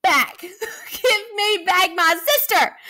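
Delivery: Lucy roaring at the monsters for Mia. Acted. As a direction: roar to get your sister back. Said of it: "Lucy roared".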